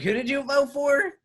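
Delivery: high mocking falsetto quiz; mock drunk question. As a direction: squeal it like a tipsy patron. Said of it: "they're like".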